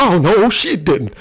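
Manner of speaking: slow, loud, sassy "oh no she didn't"; sassy retort. Retold or imitated. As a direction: drawl it out with loud sass. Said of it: "She said".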